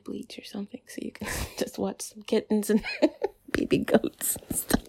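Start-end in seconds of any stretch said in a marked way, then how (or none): 2.9-3.5 short high-pitched laugh. Real, not acted. Laughing at kittens remark.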